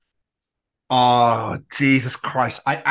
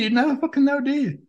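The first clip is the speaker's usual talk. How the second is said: high, far-off sarcastic voice; put-on high exasperation; mock exasperated; 11 semitones higher than usual. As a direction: lift "fucking" high in mock outrage.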